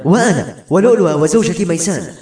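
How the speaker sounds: angry cry, high-pitched; angry shout. A narrator's reading. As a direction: call out in indignant protest. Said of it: "صاح بركات غاضبا".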